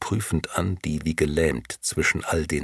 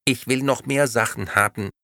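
squawking doll voice; 7 semitones higher, 3 dB louder than usual. Acted. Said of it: "quäkte die Puppe plötzlich".

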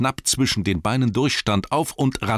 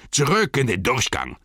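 raised, rough orc bellow; 6 semitones higher, 3 dB louder than usual. Acted. Orcs shouting back to the passage, raised bellow. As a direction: bark it like a crowd of orcs. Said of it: "brüllten einige".

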